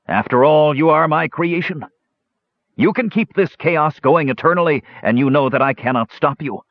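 high-pitched declamation at a foe; shouting dwarf. A narrator's reading. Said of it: "shouted the dwarf".

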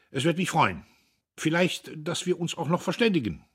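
high, loud, effortful concession; forced out. A narrator's reading. Brother concedes to the visitor, forcing out the politeness. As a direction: push out the polite words against resistance. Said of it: "brachte er hervor".

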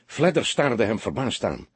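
ordinary delivery

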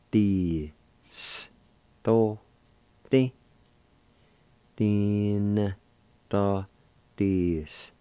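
"Thin, thaw, Ti, s, to, te" spoken syllable by syllable, each one clipped apart; teacher drilling the syllables "ti, s, to, te" one at a time. Shown as a demonstration. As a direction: sound out "Tin, to, ti, s, to, te" slowly as a drill, said three times. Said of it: "you can pronounce the N sound with the D I like this".

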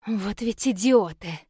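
mildly grumbled, slow exasperation; a slow, understated grumble; grumbling. A narrator's reading. Woman leaving the bedroom complains about the idiots, mildly grumbling. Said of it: "проворчала она".

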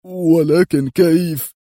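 loud, high, drawn-out question; exclaiming. A narrator's reading. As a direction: cry out slowly in disbelief. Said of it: "وقدري يهتف".

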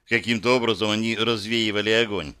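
ordinary delivery, nothing marked

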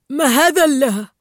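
loud startled exclamation, cut short; astonished. A narrator's reading. Dentist sees a pelican in his clinic, loud astonished outburst. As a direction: blurt it out in amazement. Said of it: "اندهش دكتور شيرمان".

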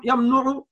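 يمنع pronounced "yamnu'u"; damma on the middle letter. Shown as a demonstration. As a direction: give يمنع a u vowel, yam-nu-'u. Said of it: "لا". (نطق يمنع بضم العين: يَمْنُعُ)

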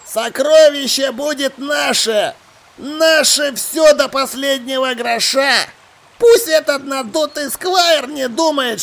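high, slow, stretched-out pirate voice; scornful pirate boasting to his mates; gloating pirate. Acted. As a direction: crow over the treasure, drawing out each word high.

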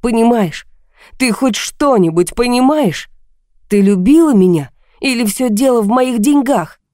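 plaintive, wounded appeal; desperate pleading. A narrator's reading. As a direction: beg to be understood. Tearful husband pleads with wife.

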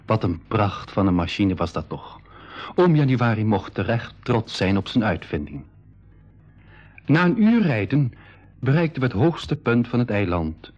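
slow, even storyteller's read; audio-drama narrator; story narration. A narrator's reading. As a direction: narrate calmly to the listener. Narrator tells the journey.

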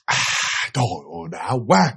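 low, soft sigh of relish; satisfied drinker. Retold or imitated. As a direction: act out savoring the first swallow. Uống một hớp, khoan khoái.

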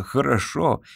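plain reading voice

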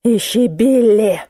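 slow, drawn-out plea, not whispered; last gasp. A narrator's reading. Exhausted narrator begs Musenka to find number, fading. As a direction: drag the words out with last strength. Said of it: "на последнем издыхании прошептала я".

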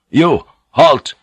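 sharp loud command to stop; shouted halt. A narrator's reading. Samurai guard calling the priest to halt, loud. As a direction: bark it out from the darkness.

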